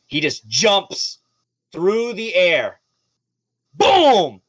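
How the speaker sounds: rising narration exploding into a loud boom; building to a shout. A narrator's reading. GM hyping the shark's leap, building to a shout. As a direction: build the leap and slam the landing.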